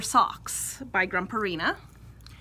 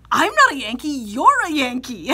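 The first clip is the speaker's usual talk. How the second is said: indignant; 4 semitones higher, 9 dB louder than usual. Retold or imitated. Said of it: "I was like".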